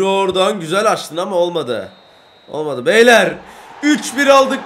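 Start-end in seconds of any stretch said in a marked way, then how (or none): 2.9-4.6 loud high cheer to the team; thrilled shout to the lads; excited win cry. Real, not acted. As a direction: announce the win with joy. Celebrating win.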